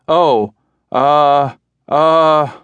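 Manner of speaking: loud, trembling oh and uh's; quaking voice. A narrator's reading. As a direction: shake on every syllable. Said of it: "His voice quaked with each syllable".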